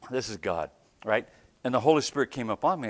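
ordinary talk, nothing marked

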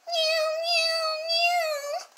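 very high kitten meows; cat meowing. Acted. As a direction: meow like a soaked cat.

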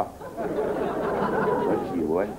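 very slow, low hoarse croak; hoarse rasp. Acted. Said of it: "The possessor of the hoarse voice".

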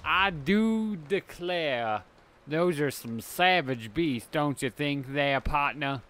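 lightly done Southern lawyer drawl, slow at first. Acted. Lawyer from Savannah in a light Southern drawl. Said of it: "I don't know why you're talking like that".

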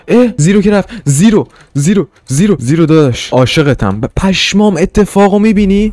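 high, thrilled yelps; can't believe what just happened; excited. Real, not acted. Calling to his buddy Zero over a sudden event, high and thrilled.

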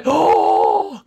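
high gasp of delight; sharp excited gasp. Acted. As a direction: gasp like you just struck gold. Excited about secrets.

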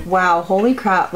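ordinary delivery

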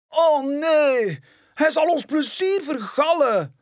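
veel hoger en luider, pruilende welp; pouting cub. Acted. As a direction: zeur het pruilend uit als een kind. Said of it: "pruilt Simba". (pruilend, hoog en luid klagend)

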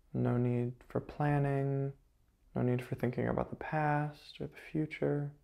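calm, level meditation guide's voice; guided meditation. Acted. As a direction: guide them gently into stillness, with long pauses.

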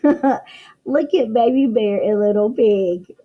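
ordinary delivery

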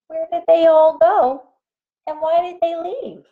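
high-pitched, puzzled little pig; wondering pig. Acted. Little pig is left alone, high puzzled wondering. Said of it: "I think he's wondering now".

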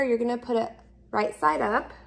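normal talking voice

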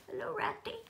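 high squeaky first try at a doll's voice; a doll greeting a friend's pet, first take, too high; wrong doll voice. Pretend. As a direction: pipe it up high, too cute for him. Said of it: "he doesn't talk like that".

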